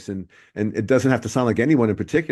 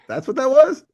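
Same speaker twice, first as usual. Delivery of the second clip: laughing; 9 semitones higher, 3 dB louder than usual. Real, not acted. Laughing at the karaoke joke.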